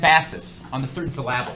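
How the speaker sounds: "emphasis" as em-PHA-sis, "syllable" as syl-LA-ble; wrong-syllable stress. Shown as a demonstration. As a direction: jokingly move the stress to the middle of "emphasis" and "syllable". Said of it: "I changed the emphasis on the different syllable".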